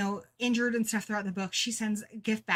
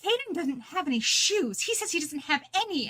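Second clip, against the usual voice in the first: higher-pitched mock scolding; imitated scolding mom; 6 semitones higher than usual. Mom telling daughter that Caden has no shoes, mock scolding. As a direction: retell a mother's telling-off, lightly acted. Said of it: "my mom scolded me".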